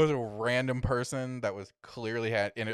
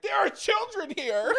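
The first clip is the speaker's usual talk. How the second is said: prim scolding; 4 dB louder, slower than usual. Retold or imitated. Retold prim reply to groper.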